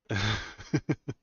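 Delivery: a real burst of loud, breathless laughter; laughing. Real, not acted. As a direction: crack up at your own joke. Laughing at own joke.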